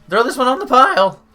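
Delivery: high, very loud rowdy holler; party shout. Acted. Partygoer orders the empty keg tossed, rowdy high shout. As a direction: bellow it over a raging party.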